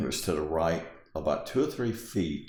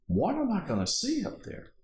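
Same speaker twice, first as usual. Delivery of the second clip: much higher, insistent query; retold puzzled question. Stranger pressing the girl about the story, retold puzzled question. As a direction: ask in high-pitched puzzlement.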